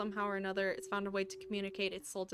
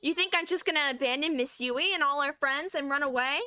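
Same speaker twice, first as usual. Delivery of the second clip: raised, heated challenge; indignant outburst; 4 semitones higher, 5 dB louder than usual. Acted. Schoolgirl refusing to abandon her friends, heated. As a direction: fire it back at them, loud and hurt. Voicing a character in a quarrel.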